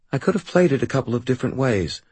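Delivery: ordinary delivery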